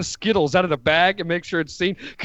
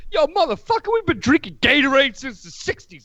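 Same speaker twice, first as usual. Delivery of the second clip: higher-pitched mock retort; indignant player; 8 semitones higher than usual. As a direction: fire back at the trainer, playfully outraged. Acted player snapping back.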